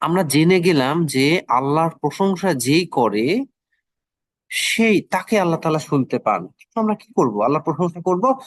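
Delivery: ordinary talk, nothing marked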